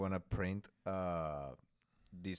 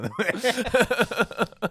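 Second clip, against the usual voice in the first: laughing; 13 semitones higher, 12 dB louder than usual. Real, not acted. Laughing apology refusing joke.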